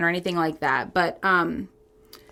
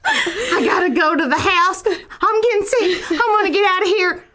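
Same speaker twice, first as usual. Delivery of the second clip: put-on Southern accent; 8 semitones higher than usual. Improvising an alien abduction witness, Southern accent. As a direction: play a rattled Southern witness. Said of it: "If you like our Southern accent".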